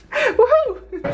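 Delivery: high whooping cheer; excited whoop. Real, not acted. Backstage before the opening ceremony, high whoop. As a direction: let out a big woohoo.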